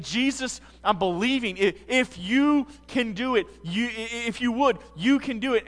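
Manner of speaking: loud, high, pleading cry; desperate plea. Retold or imitated. Leper begging Jesus on his knees, loud and high. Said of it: "And he's saying".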